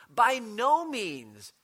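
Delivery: slow, loud and firm, each word weighted; emphatic exclamation. Retold or imitated. Preacher voicing Paul's emphatic refusal, slow and loud. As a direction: declare it like a flat refusal. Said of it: "with an exclamation point at the end".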